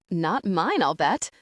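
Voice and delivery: ordinary delivery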